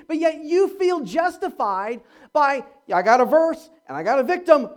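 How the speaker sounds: loud, high, scolding Paul; scolding rebuke. Retold or imitated. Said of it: "he's like".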